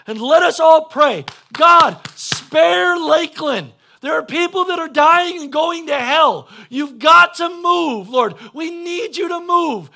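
loud, high cry to God; impassioned pleading prayer. Shown as a demonstration. Preacher praying for the lost of the town, impassioned pleading. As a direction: plead at full voice, stretching the key words. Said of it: "This is what it's supposed to sound like".

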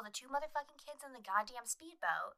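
usual speaking voice

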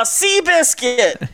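loud, high, drawn-out name call; screamed catchphrase. Shown as a demonstration. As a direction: call it out like a game announcer. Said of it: "screaming out as the ball sails".

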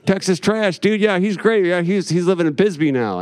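a high, laid-back dude voice, lightly voiced; stoner punk dude. Old punks telling him about his father, high, easy dude voice. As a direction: play the burned-out old punk raving about his buddy. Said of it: "And they're like".

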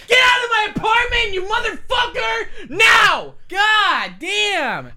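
full-throated, high-pitched screamed outburst; yelling. Acted. Host throwing Andy out of the apartment, furious yelling. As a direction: blow up and scream them out the door. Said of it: "when I started yelling".

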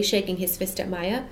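ordinary delivery, nothing marked

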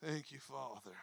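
low, soft murmur of thanks; quiet prayer. Real, not acted. Ending the sermon praying, low and soft. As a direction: breathe it as a prayer. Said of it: "prayer after sermon".

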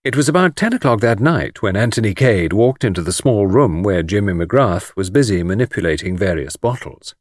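plain reading voice

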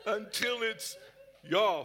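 normal talking voice

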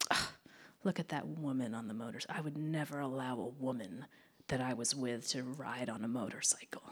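quiet, half-whispered mocking of the ex; mock ex-boyfriend. Mocking the ex-boyfriend's disapproval, hushed and half-whispered. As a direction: sneer it under your breath.

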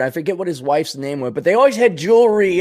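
normal talking voice

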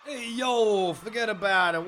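loud, high New York wiseguy voice; hey yo New York tough guy; New Yorker accent. Acted. Host greeting listeners in a New York accent. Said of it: "You do a bloody good New Yorker".

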